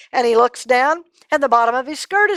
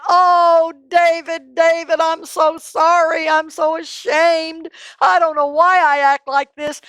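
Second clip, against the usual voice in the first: remorseful Saul; 7 semitones higher, 4 dB louder than usual. Acted. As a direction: beg forgiveness again. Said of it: "And Saul said".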